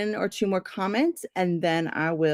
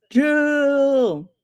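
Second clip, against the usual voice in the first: booming wrestler call of the name; 7 semitones higher, 5 dB louder than usual. Acted. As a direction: bellow it like a ring announcer. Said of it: "you got my wrestler voice out".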